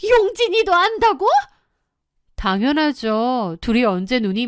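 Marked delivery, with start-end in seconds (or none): 0.1-1.3 high-pitched, startled question; shocked mother. A narrator's reading. Groom's mother learning that her son knows, shocked. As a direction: blurt it out in disbelief. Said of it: "조용진 엄마의 눈이 휘둥그레졌죠".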